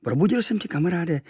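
a hint of a high foreign friend; lightly voiced islander. Kvíkvék voiced by narrator.

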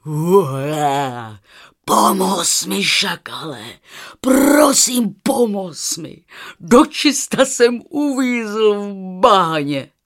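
loud, roaring lion plea; drawn-out, loud, desperate lion cry; lion calling out. A narrator's reading. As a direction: beg for help as a trapped lion. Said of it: "když na něj lev zavolal".

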